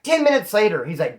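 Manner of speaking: ordinary talk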